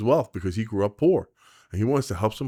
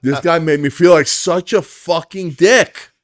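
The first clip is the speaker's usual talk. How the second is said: higher and louder, slow and emphatic; 9 semitones higher, 11 dB louder, slower than usual. Real, not acted. Agent telling the host how small he felt, loud and slow. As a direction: drive it home, slowly.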